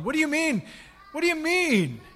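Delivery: the disciple's high-pitched scoffing repeat; mocking disbelief. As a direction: parrot it back high and incredulous. Disciples mocking Mary's report.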